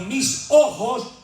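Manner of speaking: high, slow awed cry; slow, high awestruck proclamation; Isaiah in awe. Acted. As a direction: proclaim what the prophet saw. Preacher voicing Isaiah.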